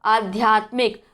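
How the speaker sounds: आध्यात्मिक said slowly with a long first आ; correct pronunciation. Shown as a demonstration. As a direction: spell out आध्यात्मिक slowly, stretching the opening आ. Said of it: "अभी से इसे सही इसका उच्चारण करना शुरू कर दीजिए".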